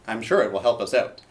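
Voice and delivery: ordinary talk, nothing marked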